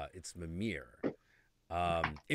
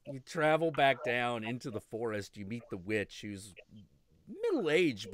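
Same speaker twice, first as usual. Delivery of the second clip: ordinary delivery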